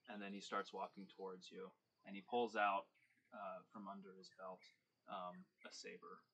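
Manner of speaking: ordinary delivery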